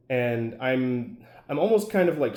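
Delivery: ordinary talk, nothing marked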